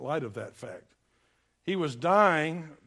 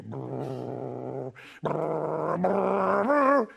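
imitated earthquake rumble; 4 dB louder, much slower than usual. Preacher imitating an approaching earthquake, rumbling brrr that builds. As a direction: trill a rumble that swells like an oncoming train. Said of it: "you'd sound like a train".

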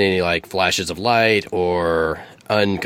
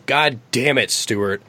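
higher, exasperated snake ranting; angry snake; 4 semitones higher than usual. Acted. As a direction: snap at your partner, fed up and high. Snake rants at the eagle.

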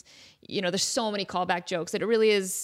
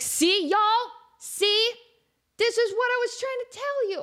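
high gloating I-told-you-so; 11 semitones higher, slower than usual. Retold or imitated. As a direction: crow it high and smug. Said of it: "And I'm like".

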